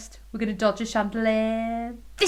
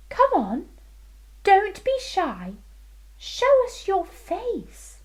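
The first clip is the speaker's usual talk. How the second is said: queen voice; 10 semitones higher than usual. Acted. Queen coaxing, voiced high.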